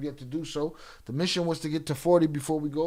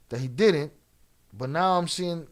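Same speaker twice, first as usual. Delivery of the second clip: ordinary talk, nothing marked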